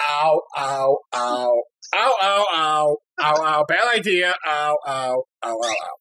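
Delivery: imitating rhythmic pained ows; mock ow ow while hopping; moaning in pain. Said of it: "hopped on one foot while going".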